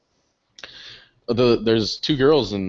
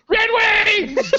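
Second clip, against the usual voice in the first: high, loud drunk squeal, imitated; drunk person's cheer; 5 dB louder than usual. Drunk girl recognising a fellow fan, mock squeal. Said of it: "Like only a drunk person can".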